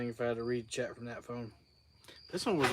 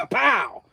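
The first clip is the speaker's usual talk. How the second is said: startled cough; sudden cough; 8 semitones higher, 10 dB louder than usual. Real, not acted. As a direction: cough out the bug. Bug flies into mouth.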